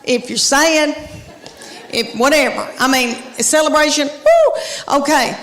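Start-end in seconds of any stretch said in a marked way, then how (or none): 4.1-5.0 high-pitched "woo"; celebratory whoop. Acted. Mimes a celebration.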